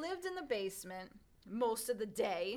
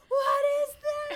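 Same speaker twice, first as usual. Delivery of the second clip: high, loud shocked scream; horrified shriek; 18 dB louder, slower than usual. Retold or imitated. Kids at a birthday party finding prosciutto legs, shrieking. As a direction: shriek at the hanging meat. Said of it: "They're like".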